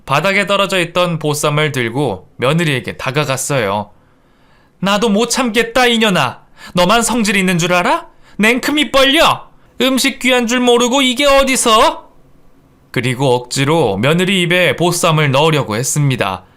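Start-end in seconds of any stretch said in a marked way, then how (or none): 4.8-12.1 high, mounting acted rage; furious scolding. Fed-up mother-in-law forcing food on daughter-in-law, furious. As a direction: explode at her, climbing in pitch.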